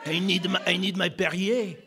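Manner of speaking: low-pitched mock French accent; thick French accent. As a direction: put on a hammed-up French accent. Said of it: "if my thick accent".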